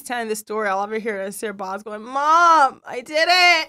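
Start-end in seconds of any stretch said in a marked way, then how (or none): 2.0-3.7 high, loud mock kid's call for mom; pitched-up little boy's confession; child calling out. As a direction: imitate a child hollering from another room. Voicing her sick son calling out.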